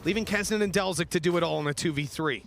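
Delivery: esports caster. Acted. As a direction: call the action as it happens. Live play-by-play call.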